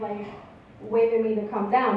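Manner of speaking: usual speaking voice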